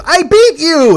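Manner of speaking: high, loud mimicked victory crow; gloating shout. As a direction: shout it gleefully in her face, exaggerated. Retold gloating, escalating each time.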